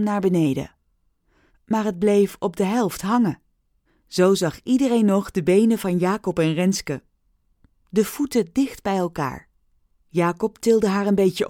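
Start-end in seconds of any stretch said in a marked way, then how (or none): none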